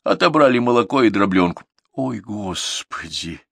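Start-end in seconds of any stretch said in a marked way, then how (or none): 1.9-3.4 dismayed gasp. A narrator's reading. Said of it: "всплеснула руками Катя".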